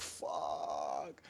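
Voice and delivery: low, drawn-out curse under the breath; sinking dread. Retold or imitated. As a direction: let it sink down softly and slowly. Retold sinking dread after threat.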